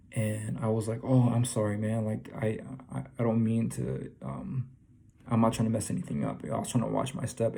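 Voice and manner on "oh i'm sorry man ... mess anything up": usual speaking voice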